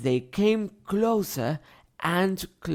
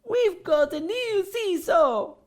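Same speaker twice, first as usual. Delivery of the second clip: much higher, eager voice; excited children. A narrator's reading. Children crowding round the seesaw, excited. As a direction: squeal about the new toy. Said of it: "shouting with excitement".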